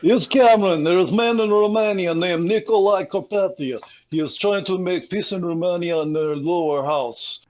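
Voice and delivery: hammed-up Israeli accent. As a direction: put on a rough attempt at an Israeli accent. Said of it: "says in his Israeli accent".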